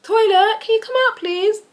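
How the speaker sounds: high, sing-song polite call through the door; sweet nan calling. Retold or imitated. Nan knocking on Tony's door, high sing-song call. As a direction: coax him out of the room, all sugar.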